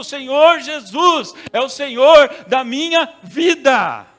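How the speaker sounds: very loud, high and slow proclamation; loud confession. Shown as a demonstration. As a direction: proclaim it so everyone hears. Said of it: "É expressar assim pra que todo mundo ouça". (proclamação muito alta, aguda e lenta)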